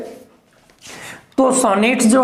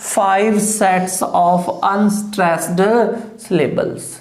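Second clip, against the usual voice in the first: reading aloud slowly; much slower than usual. Shown as a demonstration. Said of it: "तो क्या लिखा है यहाँ?".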